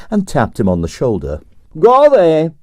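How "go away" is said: absent brush-off. Acted. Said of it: "said Stroganoff absently".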